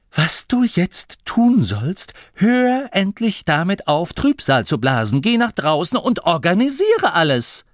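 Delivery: loud, high scolding that builds to rapped-out orders; angry snapping. Acted. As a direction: order a moper to get moving, furiously. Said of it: "fauchte Grimma". (wütend fauchend, immer schärfer)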